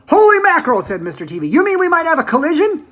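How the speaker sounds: high, loud alarmed exclamation. A narrator's reading. Mister T V hearing of a possible collision, alarmed. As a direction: gasp it out in alarm.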